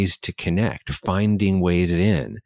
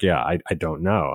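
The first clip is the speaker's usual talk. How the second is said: usual speaking voice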